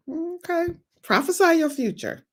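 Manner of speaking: preacher mocking the complainer; sarcastic. Real, not acted. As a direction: throw it back with sarcasm. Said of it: "sarcastic retort".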